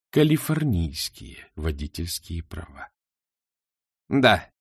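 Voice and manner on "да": gloating. Acted. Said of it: "злорадно сказала Шаяна".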